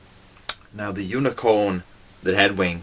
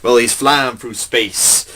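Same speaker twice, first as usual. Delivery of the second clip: ordinary delivery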